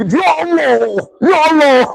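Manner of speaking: high whiny mock prayer; mock plea. Preacher mocking a whiny prayer, high and plaintive. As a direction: mimic a whining petitioner crying to God.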